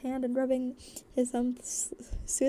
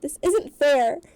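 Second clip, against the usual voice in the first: complaining; 3 semitones higher, 10 dB louder than usual. A narrator's reading. Acted complaint about waiting.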